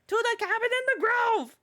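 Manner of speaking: high, loud excited welcome. Real, not acted. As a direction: gush the welcome out. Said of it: "Yeah, Fiona, welcome".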